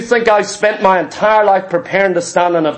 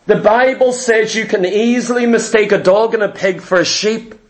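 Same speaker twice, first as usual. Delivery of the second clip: ordinary talk, nothing marked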